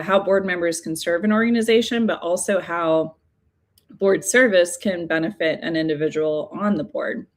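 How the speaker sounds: usual speaking voice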